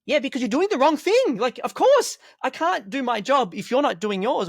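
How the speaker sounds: replaying his own exasperated answer to the student; exasperated retort. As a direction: fire back at the class, half-playful. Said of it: "it's like that whole".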